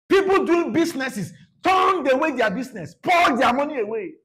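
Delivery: fiery shout at the money changers. Acted. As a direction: bellow it like overturning tables. Preacher acting out temple cleansing.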